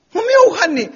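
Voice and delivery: very high loud denial; hammed-up high panicked denial; husband protesting.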